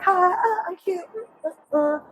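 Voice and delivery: a high, cute wrestler introduction; cutesy girly voice. Retold or imitated. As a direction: play it sweet and cute, high. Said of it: "but it's more like".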